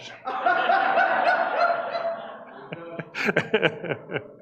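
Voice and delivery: long, high peal of laughing; real laugh that runs on and tapers; hearty laughter. Real, not acted. Speaker joking about four billion dollars, laughing hard.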